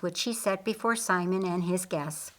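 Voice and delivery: usual speaking voice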